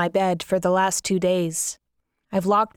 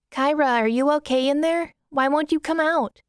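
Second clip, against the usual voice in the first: put-on higher girlish voice, concerned; little sister; 7 semitones higher than usual. Little sister calling through the door, high concerned voice. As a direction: voice her younger and higher. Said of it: "I hear Monica ask".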